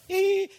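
a wince of embarrassment; embarrassed squeak. Retold or imitated. Corinthians reading the letter, a sheepish high squeak. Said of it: "They must have been kinda, like, going".

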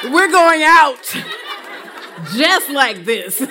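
loud, laughing through the words; laughing loudly. Real, not acted. She jokes to the counselors, laughing loudly. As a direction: laugh it out, having fun. Joking about her shoes and casket.

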